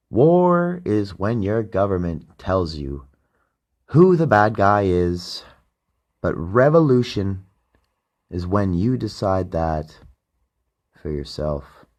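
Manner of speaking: slow, weighty recitation of a famous quote; solemn quote reading. Shown as a demonstration. Podcast host reciting a quote, slow and solemn. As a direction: read it out slowly like a closing quote. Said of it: "The end quote is Ben Franklin".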